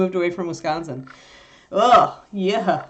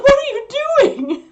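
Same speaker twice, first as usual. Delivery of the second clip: high, loud, outraged puppy voice; mock puppy indignation; 6 dB louder than usual. Owner voicing puppy outraged breakfast was composted, high loud shock. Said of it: "she was just like".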